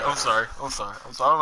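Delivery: usual speaking voice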